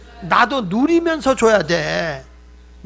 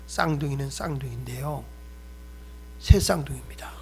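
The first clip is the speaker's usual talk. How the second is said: 낮고 조용하게, 심각한 의사처럼; 진단을 무겁게 전하는 의사를 흉내 내며 낮게; 6 semitones lower, 8 dB quieter than usual. Retold or imitated. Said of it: "의사가 심각하게 그러더래요". (심각한 의사 말투로 낮게)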